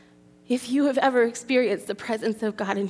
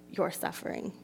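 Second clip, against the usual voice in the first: ordinary talk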